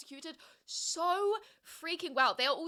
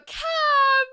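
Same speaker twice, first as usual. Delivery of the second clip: excited call; 13 dB louder than usual. Real, not acted. Greeting a viewer in chat.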